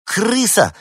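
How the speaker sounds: high, loud shriek of "Крыса"; shout. A narrator's reading. Girl spots the rat and shrieks in alarm. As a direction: scream it as you point. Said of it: "закричала рыженькая".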